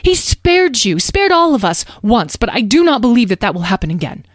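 agitated mother, high and loud, insistent; panicky. A narrator's reading. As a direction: forbid it urgently, breaking off mid-thought. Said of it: "Beatrice's face looked panicky".

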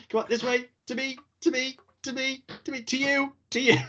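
sing-song workmen guiding a mattress in; removal men calling. Pretend. Imagining movers hauling the bed in, high sing-song calls. Mock furniture movers directing each other.

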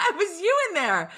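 said through a high laugh; words riding a giggle. Real, not acted. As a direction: talk while still laughing. Laughing at friend's story.

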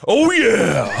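loud, drawn-out mascot holler; Kool-Aid Man. Pretend. Friend asked for the wall-smashing mascot's line, playful big bellow. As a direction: bellow the catchphrase with cartoon gusto. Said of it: "What does the Kool-Aid, Kool-Aid guy say?".

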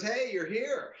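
high, upbeat mock hello; lifted, exclaiming greeting in a quote; retold surprised greeting. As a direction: imitate a host pleasantly surprised by a guest. Trump greets him after golf.

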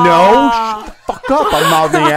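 loud, high, exasperated telling-off; hammed-up fury at his wife; mock outrage. Father silently scolding his wife over their son, hammed-up outrage.

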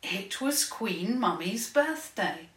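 plain reading voice

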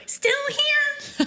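squeaky little bottle voice, giggling; a tiny laudanum bottle calling out with a giggle; tiny high voice. Pretend. The laudanum bottle giggling at Claire in a tiny squeak.

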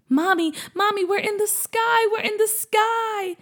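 put-on high, thrilled child voice; excited boy. Boy calls to his mom at the sky, thrilled and high. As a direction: burst out with wonder like a little kid. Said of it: "as his excitement grew".

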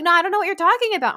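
high, loud, fast mock denial from the boyfriend; mock defensive denial. Boyfriend denying he deleted anything, high, loud and fast. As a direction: rattle it off like a guilty man caught out. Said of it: "And then he was like".

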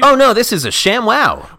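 high, loud mock sales pitch; infomercial pitchman. Pitchman correcting the napkin remark, hammed-up sales voice. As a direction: sell it like a TV huckster.

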